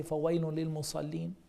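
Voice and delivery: rapid recited Arabic verse; تلاوة سريعة; Quran recitation. Acted. Quoting Quran verse again.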